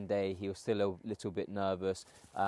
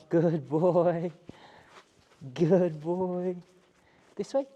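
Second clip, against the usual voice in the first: high, loud encouraging praise for a dog; 8 semitones higher, 5 dB louder, much slower than usual. Real, not acted. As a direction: cheer the dog on, higher and louder. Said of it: "that nice tone of voice".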